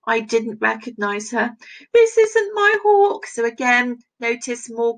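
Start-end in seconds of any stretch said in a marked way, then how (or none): 1.9-3.3 high, loud insistence on this; dismayed denial. Acted. Falconer rejecting the bird, high loud denial. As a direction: refuse it with a high, pressed voice. Reading a dismayed quote aloud.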